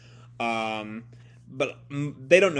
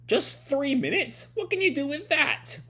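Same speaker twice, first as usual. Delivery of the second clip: mocking scientist; 12 semitones higher than usual. Caesar Clown mocking Chopper's short monster time. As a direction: belittle him from above.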